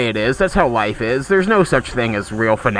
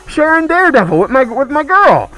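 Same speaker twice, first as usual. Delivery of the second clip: giddy boast; 11 semitones higher, 5 dB louder than usual. Real, not acted. Playfully boasting about sharing with girlfriend.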